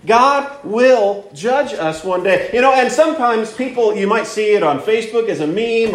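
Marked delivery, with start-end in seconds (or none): 0.0-2.5 fervent preaching. Acted. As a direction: preach it with rising conviction. Rising preaching on God as judge.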